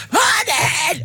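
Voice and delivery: high-pitched, full-on greeting shout; going for it loud. Shown as a demonstration. Teacher greeting parents so it needn't be repeated, loud high shout. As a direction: belt it out so nobody asks for a repeat. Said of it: "I'll go for it loud".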